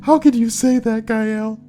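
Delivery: squeaky hammed-up offended voice; mocking triggered whiner. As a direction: parody an oversensitive complainer. Mocking easily triggered people.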